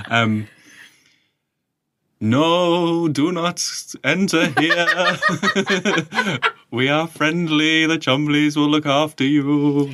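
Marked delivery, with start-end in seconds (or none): 2.2-9.9 wailing, drawn-out alien, like a fake ghost; higher, slow and ghostly, half laughing; Rill voice. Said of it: "Let me think of a real voice".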